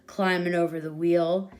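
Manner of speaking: usual speaking voice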